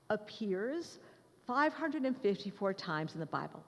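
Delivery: ordinary talk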